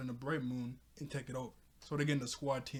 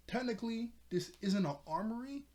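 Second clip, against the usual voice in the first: higher-pitched careful correction; acted admission; 9 semitones higher than usual. Glimmer admits the armory isn't real, higher-pitched. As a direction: pitch up on a mock-sheepish confession.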